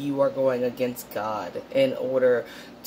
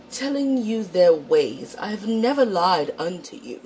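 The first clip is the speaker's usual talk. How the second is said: mock-solemn Shakespearean reading, pitched higher; 5 semitones higher than usual. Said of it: "read it to you in a Shakespearean voice".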